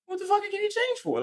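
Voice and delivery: very high and fast, baffled; incredulous squeal. Retold or imitated. Cashier retorting to the cussing lady, high rushed disbelief. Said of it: "And you're like".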